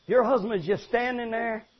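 ordinary talk

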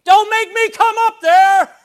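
very high, loud, slow shouted threat; parent yelling threat. Shown as a demonstration. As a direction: bellow it up the stairs like a fed-up parent. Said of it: "is not how you do it".

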